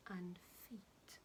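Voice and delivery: a sly whisper trailing off; whispered menace. Acted. Crocodile whispers his menu to the monkey, hushed and sly. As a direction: breathe it like a secret threat.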